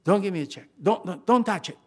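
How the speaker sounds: emphatic retold refusal; insistent acted refusal. Acts out refusing the check.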